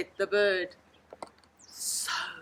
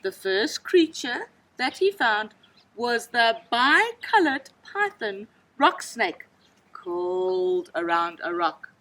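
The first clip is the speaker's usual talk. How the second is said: usual speaking voice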